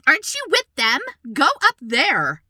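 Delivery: mock indignant stranger. Stranger telling a mother to move up, mock indignant. Retold rude stranger confronting her.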